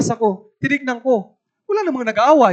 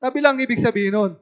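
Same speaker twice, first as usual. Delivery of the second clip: normal talking voice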